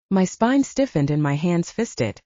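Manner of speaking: usual speaking voice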